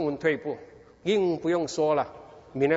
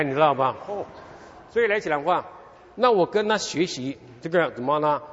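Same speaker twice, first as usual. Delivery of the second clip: ordinary talk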